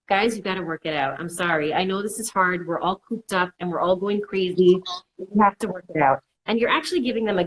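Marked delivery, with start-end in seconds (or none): none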